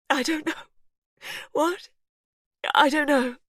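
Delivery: high, loud, dazed; lost and frightened; mother confused mid-birth, high and loud. A narrator's reading. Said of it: "Pippa sounded confused".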